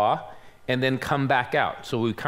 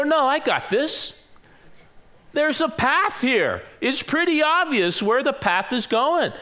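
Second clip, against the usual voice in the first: higher-pitched, cocky brush-off; breezy overconfidence; 9 semitones higher than usual. Retold or imitated. Young guide waving off Pat's map suggestion, overconfident. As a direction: wave the worry away, pitched up.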